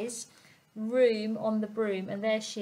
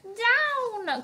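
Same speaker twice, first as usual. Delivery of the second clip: high, loud cry of "Down!"; witch's cry; 13 semitones higher, 5 dB louder than usual. A narrator's reading. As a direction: shout "Down!" up high. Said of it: "cried the witch".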